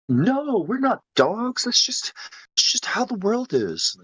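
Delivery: high-pitched, dismissive denial; mock protest. Acted denial in imagined exchange.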